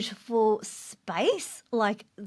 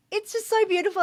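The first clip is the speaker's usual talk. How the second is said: much higher, welling up with emotion; teary awe; 11 semitones higher, faster than usual. Retold or imitated. Mum in tears at Disneyland, gushing teary awe. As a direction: voice lifting through happy tears. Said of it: "my daughter just saw me in tears".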